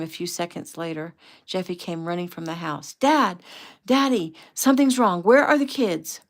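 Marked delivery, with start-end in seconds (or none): 2.9-4.3 high, loud calls for Dad; frantic cry. A narrator's reading. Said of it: "before the next cry". 4.5-5.2 "Something's wrong" shouted high and loud; frightened shout across the beach; panicked cry. A narrator's reading. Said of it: "before the next cry". 5.2-6.2 "Something's wrong" shouted high and loud; frightened shout across the beach; panicked cry. A narrator's reading. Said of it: "before the next cry".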